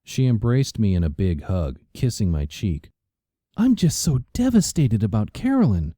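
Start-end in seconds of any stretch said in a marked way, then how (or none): 3.5-5.9 higher, airy, overdone grief; gushing socialite. A narrator's reading. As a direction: fake devastation, a higher voice. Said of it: "She looked anything but devastated".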